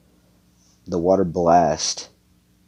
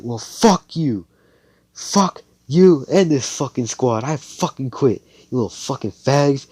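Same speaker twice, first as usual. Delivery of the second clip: higher, louder angry quitting rant; angry outburst; 7 semitones higher, 5 dB louder than usual. Acted. Girl quits the squad, angry outburst. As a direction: tell them off, voice raised.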